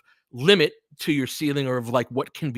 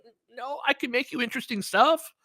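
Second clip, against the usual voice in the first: high pleading reply; 11 semitones higher than usual. Retold or imitated.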